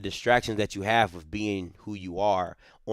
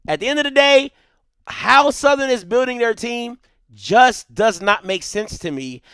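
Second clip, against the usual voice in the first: much higher and louder, building; heated rant; 12 semitones higher, 7 dB louder than usual. Real, not acted. Host ranting about Southern's team building, heated and loud. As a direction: let the frustration spill over.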